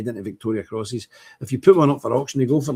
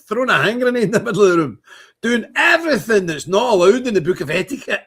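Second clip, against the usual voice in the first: laughing; 7 semitones higher, 5 dB louder than usual. Real, not acted. Laughing about Trump's manners.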